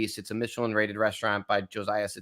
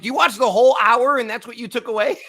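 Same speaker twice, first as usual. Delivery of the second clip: higher, louder incredulous comeback, lightly acted; mock disbelief at a nitpicking commenter; exasperated retort; 10 semitones higher, 6 dB louder than usual. Wine creator answering a pedantic commenter, incredulous and exasperated. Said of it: "I'm like".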